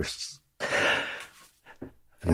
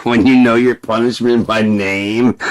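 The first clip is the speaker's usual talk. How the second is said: ordinary talk, nothing marked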